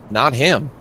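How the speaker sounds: usual speaking voice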